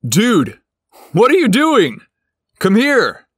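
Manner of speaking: high, loud call through the wall. A narrator's reading. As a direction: shout through thin walls. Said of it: "Steph called out".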